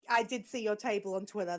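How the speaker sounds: ordinary talk, nothing marked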